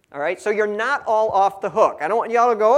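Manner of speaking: normal talking voice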